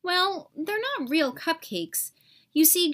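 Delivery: usual speaking voice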